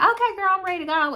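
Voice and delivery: high, sing-song mock of Megan; a friend's breezy goodbye, voiced high; mock girly friend.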